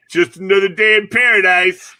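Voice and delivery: a sing-song cheery brush-off, mocked; mocking quote. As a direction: ham up the smug cheerful answer. Mocking the annoying phrase.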